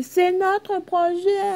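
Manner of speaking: high, slow, drawn-out campaign cry; Macron impression. Gamer mimicking Macron's rally cry, high and stretched. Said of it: "Comme Macron, il a fait".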